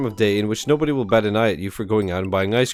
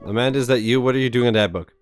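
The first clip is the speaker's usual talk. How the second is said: ordinary talk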